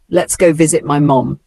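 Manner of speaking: usual speaking voice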